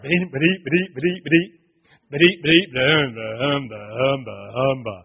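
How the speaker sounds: loud chugging imitation of an engine starting; car engine imitation. Preacher acting out a junk car being started, engine imitation. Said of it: "He goes over and tries to start".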